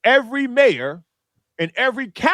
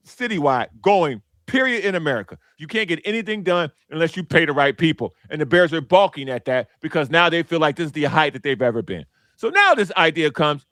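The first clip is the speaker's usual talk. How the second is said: ordinary delivery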